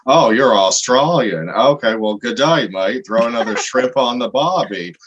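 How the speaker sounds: mocking, hammed-up fake Aussie accent; overcooked Aussie accent, drawn out; bad Australian accent. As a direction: do a douchey attempt at an Australian accent. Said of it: "trying to do your accent back to you".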